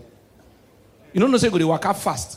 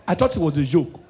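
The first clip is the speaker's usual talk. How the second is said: ordinary delivery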